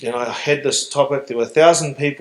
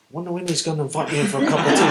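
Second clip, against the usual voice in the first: amused musing; 3 semitones higher, faster than usual. Retold or imitated. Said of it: "I'd walk past his place and go".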